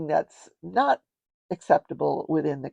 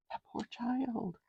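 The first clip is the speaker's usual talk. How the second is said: sighing with pity; 6 semitones higher, 12 dB quieter, slower than usual. Real, not acted. Sighing over child's letter.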